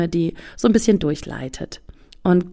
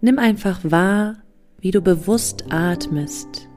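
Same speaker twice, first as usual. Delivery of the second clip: guided meditation; slower than usual. Acted. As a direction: guide them into calm, slowly. (ruhige Meditationsstimme)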